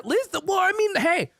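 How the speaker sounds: indignant. Pretend. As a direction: act offended at the question. Mock defensive rant to chat.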